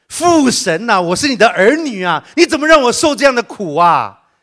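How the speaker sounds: complaining prayer. Acted. A believer cries a complaining prayer to God, loud and high. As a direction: cry out to God, hammed-up grievance. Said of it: "我们基督徒的祷告也是啊".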